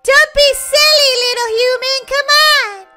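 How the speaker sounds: high, loud, cajoling call; urging call. Acted. Wolf urging the hesitant girl inside, cajoling. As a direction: coax loudly at a high pitch.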